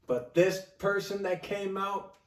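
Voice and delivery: ordinary talk, nothing marked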